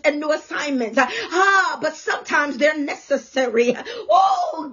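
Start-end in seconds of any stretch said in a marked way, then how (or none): 4.1-4.7 high, sudden pulpit wail on "Oh"; soaring exclamation of praise; ecstatic cry. Real, not acted. Preacher cries out to God.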